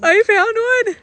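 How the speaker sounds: very high, loud squeal; real delighted cry; triumphant. Real, not acted. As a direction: shout the news. Triumphant answer.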